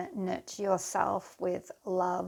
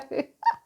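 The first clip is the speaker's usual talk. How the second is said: high, loud burst of laughter; helpless high giggle; real laugh; 6 dB louder than usual. Real, not acted. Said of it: "sorry, I shouldn't laugh".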